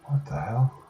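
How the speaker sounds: a bad, hammed-up Scottish accent; mock Scottish, loosely held; horrible Scottish accent. Player voicing a colonist in a bad Scottish accent. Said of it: "That's my horrible Scottish accent".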